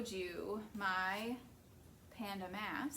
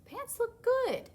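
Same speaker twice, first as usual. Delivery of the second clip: much higher, louder, delighted; gushing little panda; 13 semitones higher, 8 dB louder than usual. Acted. Little panda admires pants, delighted high squeal.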